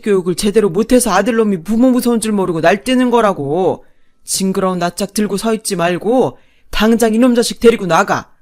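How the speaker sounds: loud furious bellow; father-in-law yelling. Acted. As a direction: roar at son and wife. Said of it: "아버님이 버럭 소리쳤죠". (버럭 소리치는 시아버지)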